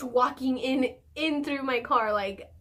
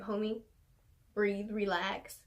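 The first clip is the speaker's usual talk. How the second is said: soft, slow, soothing quote; calming him down; 4 semitones lower, 7 dB quieter, slower than usual. Retold or imitated. Soothing an angry officer during the car search, soft and slow. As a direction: hush him like calming a friend.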